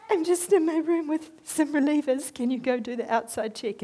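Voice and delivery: high, polite voice asking a favour; sweet request. Retold or imitated. Her own polite request.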